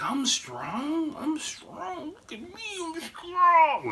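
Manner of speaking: very high-pitched drunk old man impression; mock drunken bragging, bursting out on the last word; crazed drunk boasting. Drunk grandfather showing off his strength, crazed boasting. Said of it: "fucking drunk out of his mind".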